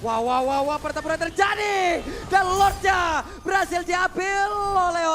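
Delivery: loud, slow shouting play-by-play; excited shout. Real, not acted. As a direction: yell out the big teamfight. Teamfight erupts, caster shouts.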